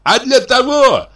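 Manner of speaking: very high, slow squeal. A narrator's reading. Brother butting in on the doctor, squealing. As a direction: squeal the answer out loudly. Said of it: "запищал Михей Егорыч".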